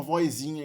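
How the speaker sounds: "vozinha" pronounced "voizinha"; inserted i glide. Shown as a demonstration. Teacher giving an example of the variant, i glide in "vozinha". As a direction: keep an i glide before the z of "vozinha". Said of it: "a gente pode falar vozinha".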